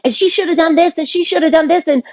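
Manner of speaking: imitating an upset brother's outburst; retold angry outburst. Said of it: "he got really loud, and he's like".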